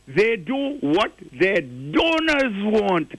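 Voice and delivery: slow, leaning hard on donors; stress on donors. Shown as a demonstration. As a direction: drag it out, hit donors. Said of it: "I underline the word donors".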